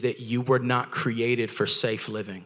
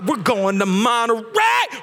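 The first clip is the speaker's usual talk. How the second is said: higher, slow drawn-out joyful shout; excited shout; 9 semitones higher, 5 dB louder, slower than usual. Retold or imitated. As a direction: stretch "Monterey" out in glee. Shouting mid-meal, excited.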